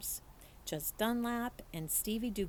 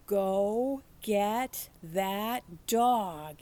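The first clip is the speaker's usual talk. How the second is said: loud, very slow yell; 7 dB louder, much slower than usual. A narrator's reading. Preacher orders Opal to fetch the dog, loud slow yell. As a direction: call it out big and drawn out. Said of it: "he yelled".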